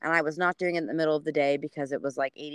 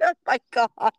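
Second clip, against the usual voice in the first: loud oh my God; exasperated groan; 5 semitones higher, 7 dB louder than usual. Retold or imitated. Runner fed up with her friend's test, loud. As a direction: react in disbelief. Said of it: "I'm like".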